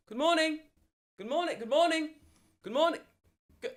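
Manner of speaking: put-on little girl calling out. Little girl greeting from down the road, high distant call. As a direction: repeat it in a high child voice. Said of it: "Who the fuck is screaming?".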